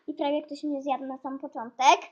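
ordinary delivery

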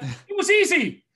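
high, drawn-out boast; triumphant crowing. Retold or imitated. Exam taker boasting to baffled onlookers, triumphant. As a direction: stretch it out high and proud. Retold gloating answer.

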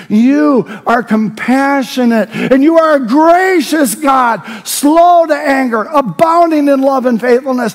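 high, loud, impassioned prayer, shouted; preacher shouting a psalm of praise, voice lifted high; full-force preaching. Acted. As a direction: cry out the psalm with rising fervour.

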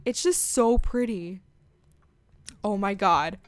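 usual speaking voice

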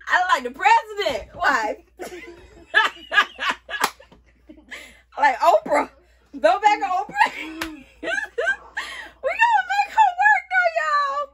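high, loud, talking through real laughter; amused at herself in the wig, laughing loudly; laughing hard. Real, not acted. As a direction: get the words out between shrieks of laughter. Laughing at how she looks.

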